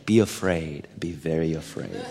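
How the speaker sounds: low, quiet, spooky warning; ominous warning. Retold or imitated. Pastor warning the young lady in a mock ominous hush. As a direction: drop into a creepy horror-movie hush. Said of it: "my response to that young lady was".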